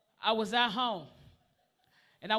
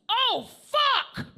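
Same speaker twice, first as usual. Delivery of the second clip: high loud jolt of alarm; mock yelp; 11 semitones higher, 7 dB louder than usual. Waking in ambulance, mock startled yelp. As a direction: snap awake with a cry.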